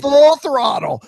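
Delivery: slow, high, loud exclamation; excited yell. Retold or imitated. Acting out the panicked pull-up.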